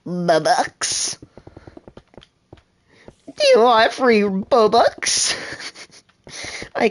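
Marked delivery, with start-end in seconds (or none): 0.0-5.3 1 mock scammer. Scam offer voice.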